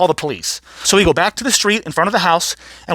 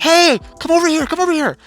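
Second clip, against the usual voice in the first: put-on much higher, girlish beckoning call; calling over; 11 semitones higher than usual. Girl at lemonade stand beckoning, high calling voice. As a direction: call out high to someone down the block.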